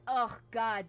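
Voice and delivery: normal talking voice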